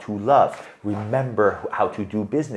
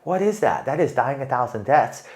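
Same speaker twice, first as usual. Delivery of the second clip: ordinary talk, nothing marked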